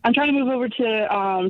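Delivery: ordinary delivery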